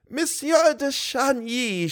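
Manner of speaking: put-on high, slow, gleeful old lady; cried gaily. A narrator's reading. Old lady gaily greets her young visitor, high and slow. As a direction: greet him with delight, drawn out. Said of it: "she cried gaily".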